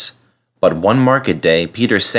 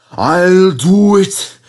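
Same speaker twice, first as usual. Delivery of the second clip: very slow, higher, louder straining gasps; gasping; 11 semitones higher, 5 dB louder, slower than usual. A narrator's reading. Broken Skarloey struggling to the station, gasping. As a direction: force it out between groans. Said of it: "he gasped between the clanks and groans".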